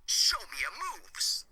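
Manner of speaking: lightly voiced video-game taunt; game catchphrase.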